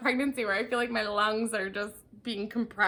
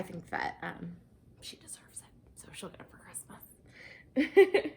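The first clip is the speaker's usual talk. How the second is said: secret whisper; 4 semitones lower, slower than usual. Real, not acted. Telling viewers mom's Christmas gift, whispering. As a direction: whisper it so mom won't find out.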